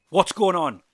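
high, loud question; alarmed demand. A narrator's reading. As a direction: call out for an explanation. Leader alarmed at their arrival.

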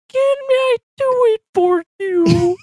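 high squeaky puppet voice; Kermit voice. Retold or imitated. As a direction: squeak it out like a Muppet frog. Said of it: "the TikTok of the, uh, the Muppets".

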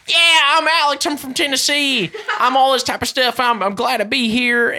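high, hammed-up Tennessee country accent. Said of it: "like, the country buck".

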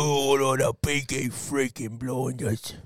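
slow, drawn-out Brummie; heavy put-on Brummie accent; Birmingham accent. Shown as a demonstration. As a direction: stretch it out in mock Birmingham. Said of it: "the Birmingham accent".

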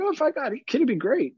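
very high, mock-naive voice; naive would-be parent. People imagining a kid is like a dog, mock-naive.

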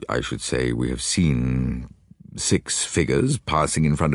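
low, halting English lord; puzzled gentleman. Acted. Lord answering the detective's question, puzzled. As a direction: feel for the words in a deep, hesitant voice.

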